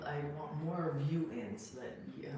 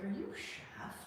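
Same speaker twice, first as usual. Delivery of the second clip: whispered question; 7 semitones higher, 6 dB quieter, slower than usual. Retold or imitated. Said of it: "I was like".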